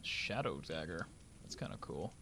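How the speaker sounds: low hushed murmur. Real, not acted. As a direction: say it under your breath. Hushed reaction to loot.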